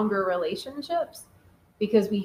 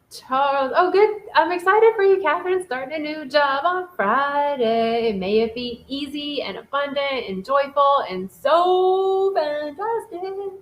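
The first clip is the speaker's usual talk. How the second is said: excited well-wishing; 5 semitones higher than usual. Real, not acted. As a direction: stretch the words out high in a gleeful singsong. Reads chat, wishes Catherine well.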